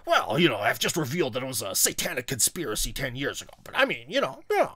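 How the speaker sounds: humble-bragging conspiracy host, swooping pitch; Alex Jones. Acted. Host imitating Alex Jones revealing a conspiracy, humble bragging. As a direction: imitate falsely modest bragging about a big revelation. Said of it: "then Alex Jones will be like".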